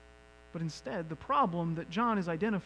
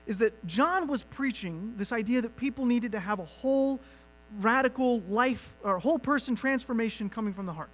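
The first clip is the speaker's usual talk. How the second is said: ordinary talk, nothing marked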